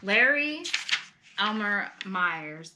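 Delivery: ordinary delivery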